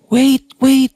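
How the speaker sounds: a voice calling out from upstairs to wait; retold shout. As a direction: call out to stop them from inside the villa. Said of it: "may sumigaw".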